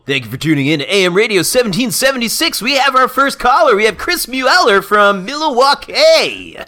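loud, high, booming AM radio host; big hammed-up broadcast welcome, stretching the last name. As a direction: announce the caller like a showman. Plays radio call-in host.

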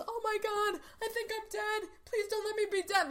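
high, frightened pleading voice; terrified plea. Retold or imitated. Conrad hit by a falling clock, pleading in high fright. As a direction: beg like you think you're dying. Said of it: "all you could hear him saying was".

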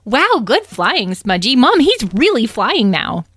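put-on high, loud, swooping kid voice; excited exclamation. Young dragling tells Mom that Smudgy is flying, excited. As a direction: exclaim it with wide-eyed delight. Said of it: "Edwin exclaimed".

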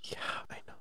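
hushed whisper. Real, not acted. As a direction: whisper it under your breath. Whispered agreement.

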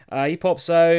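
ordinary delivery

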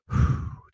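big audible breath out; calming exhale. Shown as a demonstration. After listing all the rushing, a calming exhale. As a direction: blow the rush out in one breath. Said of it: "Take the breaths".

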